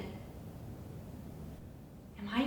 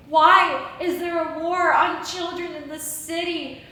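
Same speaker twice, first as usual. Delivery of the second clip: ordinary delivery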